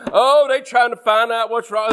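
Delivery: ordinary delivery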